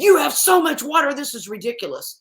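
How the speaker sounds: high, loud burst of retold outrage; mock angry. Well checker complaining about all the water in dry season, mock angry. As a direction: exclaim it like an imitated exasperated complaint. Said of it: "he was actually mad because he said".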